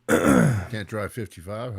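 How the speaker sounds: slow, loud announcing of the name; loud greeting. Real, not acted. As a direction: boom the name out slowly.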